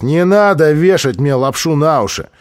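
acted angry outburst; angry retort. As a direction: cut off the excuse angrily and loudly. Thug rejects the youth's excuse.

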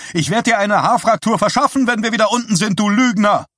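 high, loud playful threat; mock angry shout. A narrator's reading. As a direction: yell at him, laughing fury. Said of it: "rief sie ihm zu".

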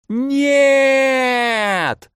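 high, piercing cry of despair; anguished scream. Acted. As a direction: scream it out in horror. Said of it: "закричала Варвара".

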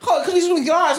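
high, mocking impression of the main character. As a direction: squeak it out as a jeering imitation. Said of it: "he's just like".

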